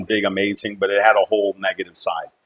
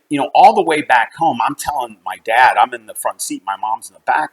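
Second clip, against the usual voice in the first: ordinary talk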